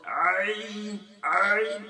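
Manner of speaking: "عین" forced with too much throat pressure; overstrained ain. Retold or imitated. Teacher mimicking learners who push too hard on the throat. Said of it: "گلے پہ بہت زیادہ زور".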